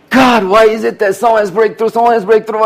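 mock whine. Whining to God, mock complaint.

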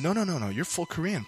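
hushed, quick-to-deny mother voice; dismissive denial. Retold or imitated. Mother hiding the truth from daughter, soft denial.

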